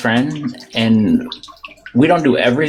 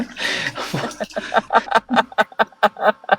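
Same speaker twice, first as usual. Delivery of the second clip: high laugh; 9 semitones higher, 4 dB quieter than usual. Real, not acted. Big laugh after chips.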